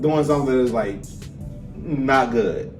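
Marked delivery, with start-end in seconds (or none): none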